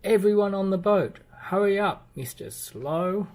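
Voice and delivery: high, loud hurry call, slowing on the last name; urgent calling out. A narrator's reading. Mister Busy hurrying friends onto the boat, loud high call. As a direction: herd everyone onto the boat.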